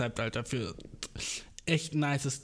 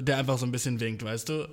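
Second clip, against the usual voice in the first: normal talking voice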